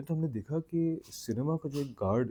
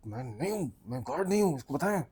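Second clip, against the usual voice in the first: indignant pleading; 3 semitones higher than usual. Retold or imitated. Pilot friend begging storyteller to say he's no guard, indignant. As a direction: mock-desperate denial, rising high.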